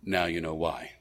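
low, soft and quiet. Retold or imitated. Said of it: "Khrushchev replied quietly".

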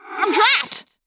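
high, frantic cry for help; panicked cry. Acted. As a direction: cry out, caught and scared. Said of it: "Marco cried".

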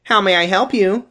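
high, bright receptionist greeting; front desk lady voice. Retold or imitated. Receptionist greeting a patient, chirpy. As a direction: sing it out like a front desk clerk. To have that voice?.

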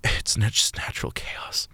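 slow, weak whisper; faint whisper of a dying soldier. A narrator's reading. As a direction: breathe it out as life fades. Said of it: "Rose whispered".

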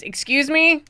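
[name] in character. Acted. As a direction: pipe up politely. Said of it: "[name] ... says".

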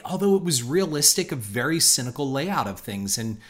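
usual speaking voice

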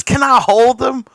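high, eager question; eager ask. Retold or imitated. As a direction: plead sweetly to hold the baby. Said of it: "and says".

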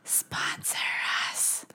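unvoiced playful whisper; breathy aside to the audience. Joking sponsor plea.

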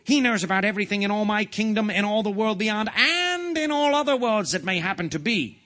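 high, loud proclamation, climbing as it goes; ordering king. A narrator's reading. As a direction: pile it up like a ranting monarch. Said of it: "ordered the king".

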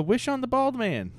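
high, loud call to a daughter; mother's yell. Retold or imitated. Mother to her daughter in the mall, imitated high yell. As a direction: imitate a mom yelling out an instruction. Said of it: "mother yells to her daughter".

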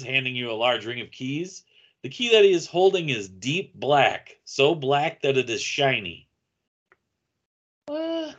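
normal talking voice

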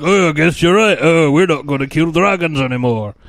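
put-on loud, grudging Scottish accent; mock Scottish Viking dad. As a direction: give in as a hammed-up Scottish dad. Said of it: "I'm a Scottish Viking".

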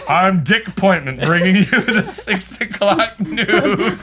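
slow newsreader delivery through laughter; news anchor voice. Pretend. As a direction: announce it like the evening news. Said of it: "sounds like a news anchor".

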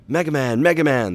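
high, loud urgent calling; frantic yell. A narrator's reading. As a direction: yell for someone who isn't answering. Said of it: "the doctor yelled".

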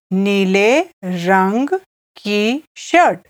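word by word with pauses; slow repeat. Shown as a demonstration. Teacher repeats the Hindi phrase for listeners, slow. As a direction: separate each word slowly for a learner.

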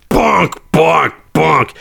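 mock bonking sound effect; bonk noise. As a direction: bang it out like a cartoon bonk. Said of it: "they just take that and they go".

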